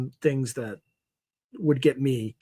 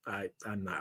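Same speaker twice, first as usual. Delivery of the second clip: ordinary talk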